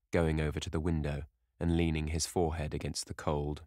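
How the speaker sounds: usual speaking voice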